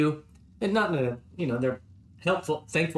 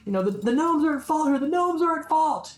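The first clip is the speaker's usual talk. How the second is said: high, raised militia guard voice; guard captain; 11 semitones higher, faster than usual. Acted. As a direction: insist the gnomes are to blame.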